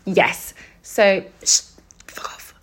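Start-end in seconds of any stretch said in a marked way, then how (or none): 1.4-2.5 hushed, breathy whisper of a curse; whispered swear. Real, not acted. Whispering a curse at the annoying girl. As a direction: whisper it so nobody hears. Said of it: "Oops, sweary".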